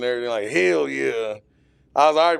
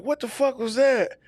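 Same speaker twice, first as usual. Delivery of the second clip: shocked; 11 semitones higher than usual. Retold or imitated. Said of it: "And I'm like".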